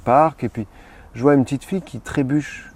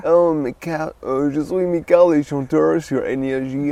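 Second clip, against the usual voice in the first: lightly higher pop-star voice; a radio shout-out in a higher voice; 4 semitones higher than usual. Shown as a demonstration. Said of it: "[name], là".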